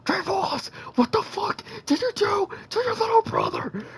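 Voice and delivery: very high, loud squawking quote; hammed-up horror in a piercing high voice; mock-shocked outburst. [name] finding the dead little brother, hammed-up shock.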